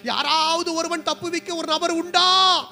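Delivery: loud, rising pulpit cry; impassioned preaching. Acted. Preacher voices a besieged group begging for a rescuer, impassioned. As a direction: preach it urgently, stretching the last plea.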